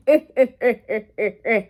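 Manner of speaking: imitated creepy "eh, eh, eh" cackle; creepy laugh. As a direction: mimic a creepy man's laugh in separate bursts. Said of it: "lets out this fucking creepy ass laugh".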